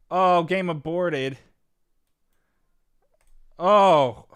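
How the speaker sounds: shocked disbelief. Real, not acted. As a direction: stretch it out, stunned the game just ended. Opponent suddenly aborts the game.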